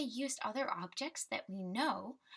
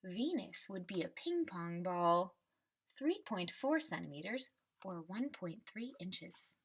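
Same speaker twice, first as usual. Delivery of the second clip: put-on reading to children. Teacher reading the class a picture book, storybook voice. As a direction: read the refrain like a picture book for kids.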